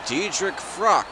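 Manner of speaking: high, slow, drawn-out name call; ring announcer. Shown as a demonstration. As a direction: boom the name like a boxing announcer. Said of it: "ring announcer introduction".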